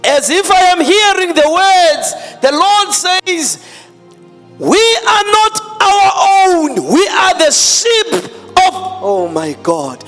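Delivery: rising to a tearful shout; impassioned preaching shout. Real, not acted. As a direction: cry out the declaration. Preaching climax, shouting, crying.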